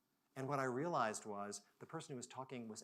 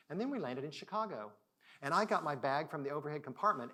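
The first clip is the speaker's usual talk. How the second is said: usual speaking voice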